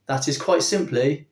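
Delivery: normal talking voice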